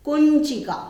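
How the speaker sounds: कुंचिका said high and loud, each syllable crisp; teacher calling out कुंचिका to be repeated; clear pronunciation demo. Shown as a demonstration. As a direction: model कुंचिका loudly for the class to repeat. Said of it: "इसका उच्चारण करना है हमको".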